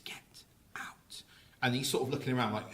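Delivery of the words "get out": breathy unvoiced whisper, very soft. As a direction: whisper it like a hidden ghost. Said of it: "It whispers, it says".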